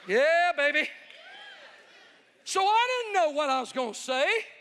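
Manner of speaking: loud, high hype shout to the crowd; excited shout. Real, not acted. Preacher shouting to the crowd, fired up. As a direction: fire up the congregation.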